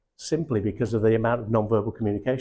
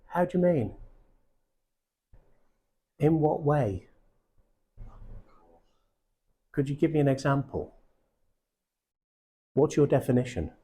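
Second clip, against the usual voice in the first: curious, higher follow-up questions; inquisitive questions; 4 semitones higher than usual. Shown as a demonstration. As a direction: ask each one with open curiosity. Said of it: "humongously complicated and profound".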